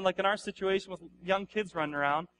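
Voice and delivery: ordinary talk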